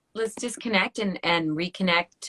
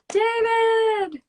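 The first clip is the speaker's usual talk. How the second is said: calling out a name happily; cheerful high greeting call; excited call; 5 dB louder than usual. Real, not acted. Greeting guest rejoining stream.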